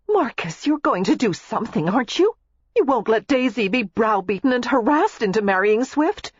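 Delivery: high, pressing plea; anxious pleading wife. A narrator's reading. Wife anxiously presses her husband to protect her sister, pleading.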